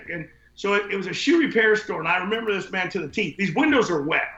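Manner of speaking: normal talking voice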